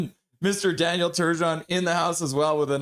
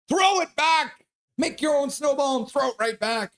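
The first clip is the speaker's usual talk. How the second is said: urging on; 10 semitones higher than usual. Real, not acted. Streamer egging on a viewer hit by snow, excited. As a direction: fire up the viewer to strike back.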